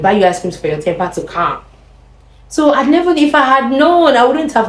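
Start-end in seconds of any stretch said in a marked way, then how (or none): none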